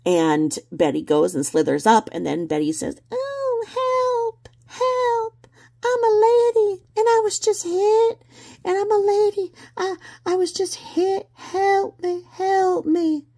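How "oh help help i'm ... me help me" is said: much higher, hammed-up helpless lady cry for help; mock damsel in distress. Betty mocked as a damsel crying for help. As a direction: squeal it like a fake victim begging. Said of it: "she plays the damsel in distress".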